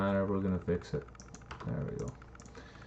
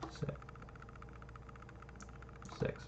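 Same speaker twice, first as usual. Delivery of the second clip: usual speaking voice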